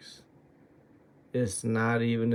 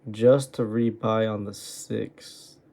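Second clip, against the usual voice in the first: exasperated; 9 dB louder, slower than usual. Real, not acted. Exasperated at his own trades.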